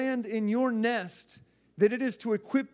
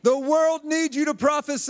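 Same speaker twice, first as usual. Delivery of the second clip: loud, high preacher's call; rousing declaration; 5 semitones higher, 5 dB louder than usual. Real, not acted. Preacher leads congregation in declaration, rousing shout. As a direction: call it out for the crowd to repeat.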